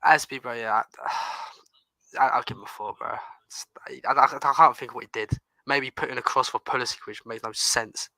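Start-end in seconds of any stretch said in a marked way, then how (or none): 1.0-4.0 soft breathy mumble, out of energy; half-whispered, giving up; deflated whisper. Real, not acted. Co-host whispering a grudging rating for Aspie.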